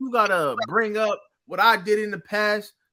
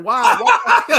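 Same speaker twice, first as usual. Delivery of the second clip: high, loud laughing why; loud laughing outcry; laughing disbelief; 12 semitones higher, 9 dB louder than usual. Real, not acted. Laughing mock outrage.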